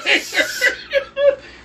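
very high laughing squeal. Real, not acted. Laughs at his own bit.

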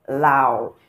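"Lao" dipping down, then up; falling-rising tone. Shown as a demonstration. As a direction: go down and come up on "Lao". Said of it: "this one is falling and then it is rising".

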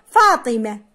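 فاطمة with no t, ending in h; final ta dropped. Shown as a demonstration. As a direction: say فاطمة as faatimah. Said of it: "عندما أقول". (نطق فاطمة بحذف التاء: فاطمه)

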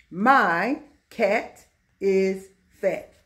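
very slow, each word set apart; word-by-word reading. Shown as a demonstration. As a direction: read it slowly for young learners. Teacher rereads example word by word.

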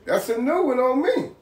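high-pitched mock surprise at a new saying. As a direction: play it amused and caught off guard. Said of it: "I was like".

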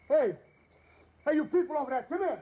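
high, carrying call across the camp. Acted. A father calls the camp neighbours over to help. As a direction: hail the people over there.

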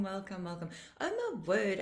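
ordinary delivery

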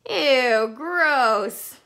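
loud, high disgusted ew; loud playful disgust at food spray; grossed out. Acted. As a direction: recoil from flying crumbs.

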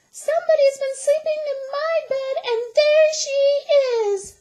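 very high, fast, loud little squeal; Baby Bear voice. A narrator's reading. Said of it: "said wee little Baby Bear".

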